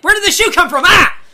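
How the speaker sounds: high, loud bewildered shout; startled yell. Retold or imitated. Wrestler hit by a thrown shoe, loud startled yell. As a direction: yelp as if hit from nowhere. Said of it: "and he's like".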